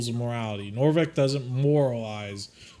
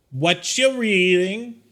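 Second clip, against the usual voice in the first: slow, loud, higher fiend voice; gruff fiend question; 7 semitones higher, 6 dB louder, slower than usual. Acted. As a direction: sneer the question slowly.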